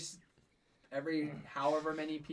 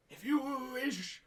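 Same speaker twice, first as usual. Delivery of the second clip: put-on strained, high old wizard, slowly drawn out; creaky, effortful elder voice; raspy old man; 12 semitones higher, 6 dB louder, slower than usual. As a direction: strain it out like a ninety-year-old. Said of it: "he has a really raspy, like, strained old man voice".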